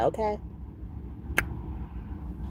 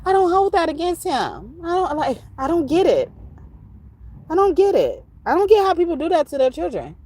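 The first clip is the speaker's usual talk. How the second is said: high disbelief; 12 semitones higher than usual. Real, not acted. Upset about grudges on children.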